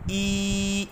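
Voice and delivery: a clean ای; zer sound. Shown as a demonstration. Teacher sounding the zer vowel for the students. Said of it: "زیر کی آواز نکالی جاتی ہے".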